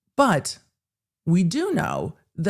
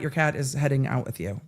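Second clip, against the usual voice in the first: ordinary talk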